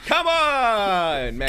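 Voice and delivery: high, loud, exasperated plea; loud protest. Real, not acted. Egging him on, protesting.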